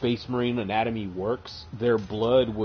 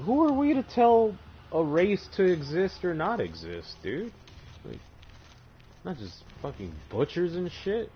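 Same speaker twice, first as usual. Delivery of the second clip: lightly voiced locals, higher and indignant; higher mock-indignant locals; 7 semitones higher than usual. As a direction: play the locals as preachy, pitched up. Locals lecturing Horus.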